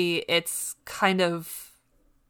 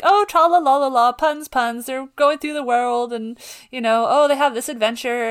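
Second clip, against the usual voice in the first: mocking cheery; 5 semitones higher, 3 dB louder than usual. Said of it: "And then it's just like".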